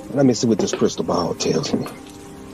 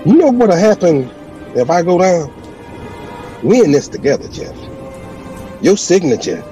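threatening shout; 6 semitones higher than usual. Acted. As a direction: threaten him at full volume. Villain threatens his lawyer.

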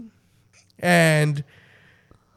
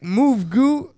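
raised, mildly urgent order; retold order to move; 6 semitones higher, 3 dB louder than usual. Speaker retells telling Goo to clear the door, mildly urgent order. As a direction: tell him to move, voice lifted a little. Acted first try at the door.

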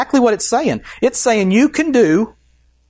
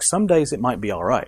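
sheepish, softer mumbled excuse; mock listener; 6 semitones lower, 5 dB quieter than usual. Preacher imitates a tempted believer, softer and sheepish. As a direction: mumble it like a half-hearted excuse. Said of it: "You're like".

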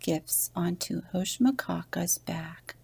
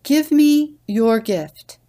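higher, louder and slow, grand; grandly; 8 semitones higher, 11 dB louder, slower than usual. A narrator's reading. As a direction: pronounce it like a pompous lord. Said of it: "he said grandly".

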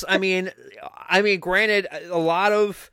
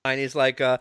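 ordinary talk, nothing marked

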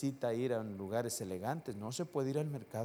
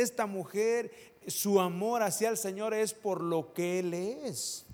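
raised, higher preaching voice, slowing to press each phrase; preaching at full force; 8 semitones higher, 3 dB louder than usual. Acted. Pastor urging the congregation, preaching at full force. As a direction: preach it out to the whole church.

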